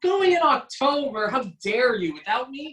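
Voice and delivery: mock outrage. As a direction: act playfully scandalised at being left out. Host feigns outrage at guest's trip.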